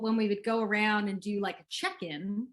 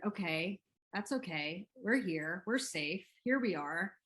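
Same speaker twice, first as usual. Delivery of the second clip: softer, gentle reassurance to yourself; soothing self-talk; 6 dB quieter than usual. Shown as a demonstration. Parent calming own nervous system, soft reassuring murmur. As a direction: settle yourself down softly, like calming a scared child. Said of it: "how to notice".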